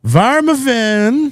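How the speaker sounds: high, long-drawn mock German call; German accent. Homie hollering the new nickname at his friend, German accent. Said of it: "put the German accent on there".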